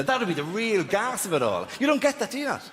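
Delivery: normal talking voice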